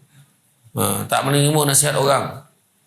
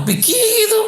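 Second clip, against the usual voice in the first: laughing; slower than usual. Real, not acted. Laughing aside in story.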